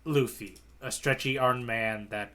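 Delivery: ordinary delivery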